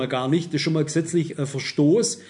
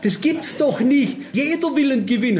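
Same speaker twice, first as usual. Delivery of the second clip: lottery caller can't believe anyone refuses a prize, high mock shock; imitating shocked disbelief; 7 semitones higher than usual. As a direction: drag out the caller's stunned protest, pitched up. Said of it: "Die war völlig geschockt".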